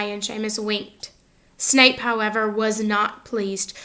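ordinary delivery, nothing marked

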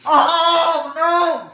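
pained yelp, high and loud; nose burning. Real, not acted. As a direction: cry out, nose on fire. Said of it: "my nose is on fire".

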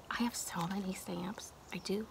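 unvoiced secretive whisper; hushed confession to viewers; confiding whisper. Real, not acted. Confiding about stamp collection.

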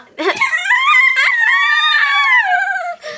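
high, loud shrieking cry; shrill scream. Pretend. Hamster screaming at eagle attack.

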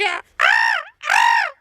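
high shouted crow caw; crow caw imitation. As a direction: call out like a crow. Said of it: "ben karga ses çıkartıyorum".

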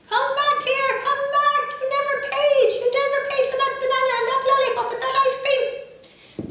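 high, loud shopkeepers yelling after him; shopkeepers shouting. Acted. Shopkeepers chase the elephant.

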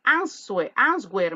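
imitated student saying "answer" as ans-wer; students' mispronunciation. Teacher quotes students' error, "answer" with a spoken W. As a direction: say "answer" with the written W sounded. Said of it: "muchos alumnos que yo escucho que me dicen".

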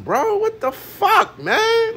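high, drawn-out cry of disbelief; incredulous. Real, not acted. Venting to viewers about the quarterback story, incredulous.

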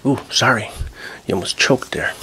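ordinary delivery

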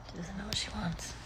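barely audible whisper; hushed, breath-only aside. Real, not acted. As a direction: whisper it under your breath. Aside about the dog.

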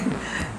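brief, very quiet laugh; soft chuckle. Real, not acted. Teacher moving the class on, soft short chuckle. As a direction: let out a small breathy chuckle.

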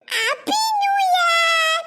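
put-on very slow, high, crying child's voice; little girl's tearful goodbye greeting. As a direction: drag it out through tears.

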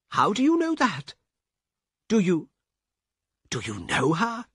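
high, startled asking; a shaken man questioning the guard; stunned question. Acted. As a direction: ask in disbelief.

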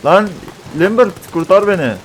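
high, loud pleading shout; desperate cry for help. Real, not acted. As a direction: yell for rescue in panic. Begging ally for help. (çaresizce yardım isteyerek)

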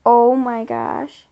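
slow loud oh my gosh; slow loud gasp of wonder; amazed. Real, not acted. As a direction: stretch it out in awe. Amazed at big item.

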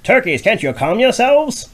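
high loud scolding mother hen. Acted. Mama Hen scolding the noisy turkeys, high and loud. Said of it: "she scolded loud and quick".

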